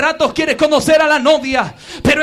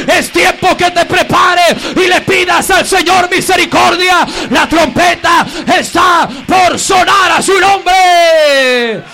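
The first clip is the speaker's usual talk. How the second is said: full-force preaching; 2 semitones higher, 3 dB louder than usual. Real, not acted. Pastor warning careless listeners the trumpet nears, full-force preaching. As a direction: thunder the warning, then let it sink.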